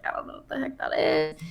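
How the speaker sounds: whispered, low and hushed; whispered aside. Retold or imitated. Gamer recalling melee confusion to cohosts, whispering. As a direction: hush it like a confused secret. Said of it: "which I was like".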